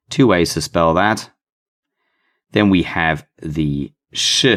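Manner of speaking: ordinary delivery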